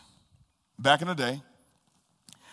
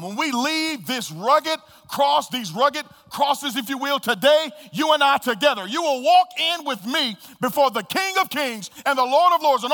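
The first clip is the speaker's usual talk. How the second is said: louder, higher preaching climax; preacher rousing the congregation to the climax; full-force preaching; 9 semitones higher, 5 dB louder than usual. Acted. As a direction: build it up and shout the promise.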